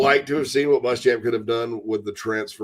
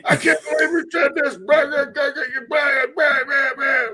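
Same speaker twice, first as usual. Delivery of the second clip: high-pitched imitation of ranting friends; mock cussing rant; 13 semitones higher than usual. Host imitates friends venting during the game, mock rant. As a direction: gabble an outraged complaint, much higher than usual. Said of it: "they'll just sit there and start cussing at me".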